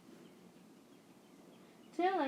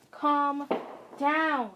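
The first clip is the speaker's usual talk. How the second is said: frustrated shout; 3 semitones higher, 7 dB louder, much slower than usual. Acted. Kid snaps back at mom.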